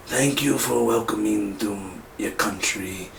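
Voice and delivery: a lightly put-on Wakandan accent, slow and soft; slow, quiet Wakandan accent imitation. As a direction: mimic T'Challa's African accent, badly. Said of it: "I'm doing a bad impression".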